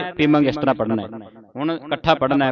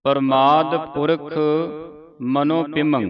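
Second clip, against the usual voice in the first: teacher shows where to pause; pause placement. Shown as a demonstration. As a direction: pause between ਪ੍ਰਮਾਦ Purakh and ਮਨੋ ਪਿਮੰ. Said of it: "ਇੱਥੇ ਵਿਸਰਾਮ ਹੈ".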